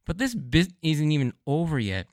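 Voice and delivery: ordinary talk, nothing marked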